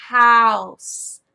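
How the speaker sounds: loud, stretched-out word for little learners; big, slow teacher enunciation; exaggerated for young kids. Shown as a demonstration. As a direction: draw out HOUSE long and loud, letting it fall. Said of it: "you will really wanna say".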